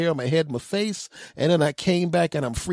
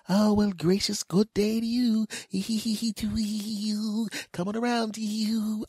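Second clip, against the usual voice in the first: high, giggly mock sweetness; gushing cashier; 6 semitones higher, 4 dB quieter, slower than usual. Cashier flirting over the machine, gushing. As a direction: imitate a twittery, over-gracious server.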